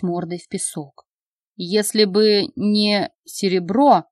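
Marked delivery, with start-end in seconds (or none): 1.6-4.0 медленно, с хрипотцой; выговорить хрипло, через силу. Acted. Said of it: "хрипло выговорил лекарь". (хриплое «Если бы не серебро»)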